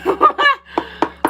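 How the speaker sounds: loud rising laugh; real laugh; high laugh. Real, not acted.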